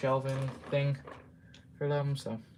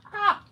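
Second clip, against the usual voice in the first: loud high yelp of pain; sharp pained cry; 10 dB louder than usual. Real, not acted. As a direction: cry out from a stab of toothache. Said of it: "my teeth hurt".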